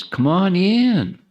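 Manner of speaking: slow, high, drawn-out Texas welcome; Texas drawl. Retold or imitated. Texas accent welcoming latecomers to come forward. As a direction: stretch it out like a friendly Texan. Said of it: "As they say in Texas".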